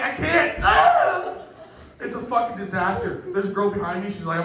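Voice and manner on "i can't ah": high strained cry ending in a yell; mock distressed wail. Man throwing up answers his wife, mock helpless wail.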